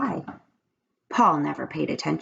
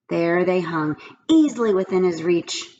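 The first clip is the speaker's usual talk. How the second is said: usual speaking voice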